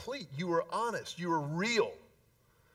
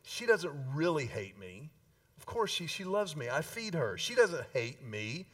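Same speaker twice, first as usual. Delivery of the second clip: calm mature mother; 7 semitones lower, 5 dB quieter than usual. Retold or imitated. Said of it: "the mature mother looks at that little five-year and goes".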